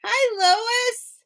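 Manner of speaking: excited greeting. Real, not acted. Greets viewer joining live.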